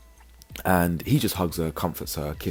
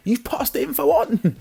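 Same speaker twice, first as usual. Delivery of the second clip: high, loud squeal of disbelief; outraged disbelief; 6 dB louder than usual. Real, not acted. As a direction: shoot up in pitch, appalled. Outraged at Callum's gossip.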